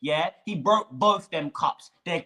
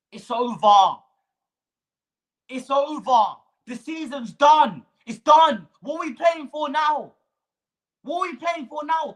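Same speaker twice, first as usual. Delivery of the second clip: higher and louder furious rant; angry shout; 7 semitones higher, 4 dB louder than usual. Real, not acted. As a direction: shout it in despair.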